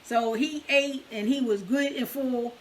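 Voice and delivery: normal talking voice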